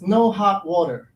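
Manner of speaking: ordinary talk, nothing marked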